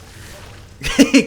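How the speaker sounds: loud real laugh at himself; burst of laughter. Real, not acted. Laughing at himself after pausing his own video. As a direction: laugh out loud, caught off guard.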